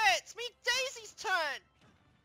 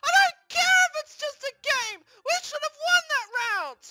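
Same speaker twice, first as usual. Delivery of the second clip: high, shouted sore-loser fury; tantrum; 13 semitones higher, 9 dB louder than usual. Acted. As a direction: yell back, refusing to calm down. Sore loser refuses to calm down.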